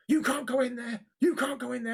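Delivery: high, repeated officious protest; retold indignant shout. Stranger protesting at the work gates, mock-angry. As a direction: imitate a man shouting a ban.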